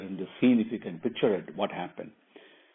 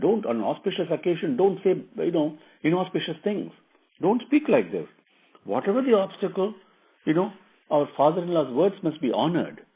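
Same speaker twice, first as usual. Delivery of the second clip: lightly voiced wife, higher pitch; earnest wife Sita; 6 semitones higher than usual. Wife urging her husband on an auspicious occasion, earnest and higher. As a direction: plead in a raised, insistent pitch.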